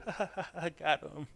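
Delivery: high, slow mock giggle; giggling troll. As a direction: imitate a troll giggling in triumph. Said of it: "fucking giggling his ass off".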